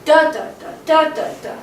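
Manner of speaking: first "da" of each three high and strong, next two falling; strong-weak-weak beat on each "da, da, da"; dactyl rhythm. Shown as a demonstration. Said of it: "two dactyls".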